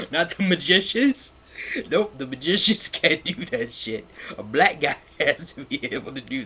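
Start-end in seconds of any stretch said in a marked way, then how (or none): 0.1-4.1 sarcastic laughing. Real, not acted.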